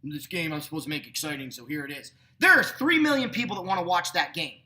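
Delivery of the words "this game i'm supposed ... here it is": low, muttered dismissive imitation; sarcastic grumble, dropped low and soft; mock sarcasm. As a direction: quietly mimic bored, sarcastic naysayers. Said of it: "I certainly don't like the sarcasm from people's mouths".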